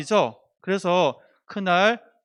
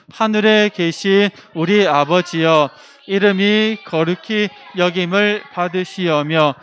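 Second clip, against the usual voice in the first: slow, loud recitation of the Lord's Prayer; loud unison prayer; 9 dB louder, slower than usual. Real, not acted. The group closing worship with the Lord's Prayer, slow loud unison chant. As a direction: chant the prayer together slowly and loudly. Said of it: "시작".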